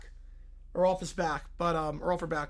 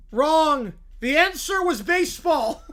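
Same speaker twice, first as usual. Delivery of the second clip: host verdict; 7 semitones higher, 8 dB louder, slower than usual. Acted. Host ruling.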